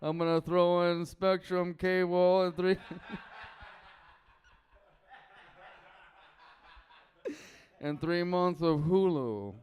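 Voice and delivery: hammed-up mafia don selling internet bundles; Don Corleone. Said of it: "this is my impression of Don Corleone".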